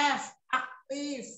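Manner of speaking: slow, punching the letter "F" before "aktif"; teacher drilling the spelling of "aktif"; stressing the F. Shown as a demonstration. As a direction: hit the "F", then say "aktif" slowly. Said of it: "aktif itu pakai".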